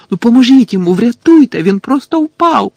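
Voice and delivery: high, loud frantic plea; panicked shouting, pitched high; desperate pleading. A narrator's reading. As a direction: beg for help at the top of your voice. Harry desperately begs for help.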